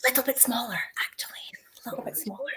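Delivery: hushed whisper. Real, not acted. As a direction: whisper it like a secret. Whispered aside about his height.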